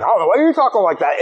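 fast, thick-tongued retort; numb-mouthed talk. Retold or imitated. Matt throwing the question back at his friend, numb-mouthed. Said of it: "our mouths had just totally gone numb".

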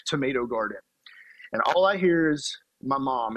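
ordinary talk

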